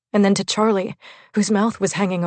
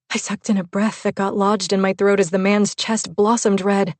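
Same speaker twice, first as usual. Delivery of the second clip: ordinary delivery, nothing marked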